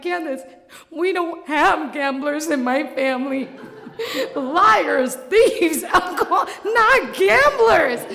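high, loud, laughing panic; hammed-up hysterical outburst; frantic rant. Panicked rant about family.

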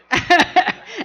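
high, loud mock laugh; loud burst of high laughter; imitated laughing. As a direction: imitate the gate kid cracking up. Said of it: "and he's laughing".

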